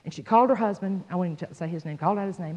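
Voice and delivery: ordinary talk, nothing marked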